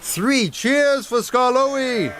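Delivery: high, slow, drawn-out cheer; rally the crowd with a big cheer. A narrator's reading. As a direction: farmers cheering for the brave engine, high and slow. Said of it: "the farmers cheered".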